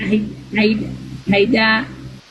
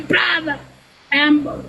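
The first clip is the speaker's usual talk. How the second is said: ordinary talk, nothing marked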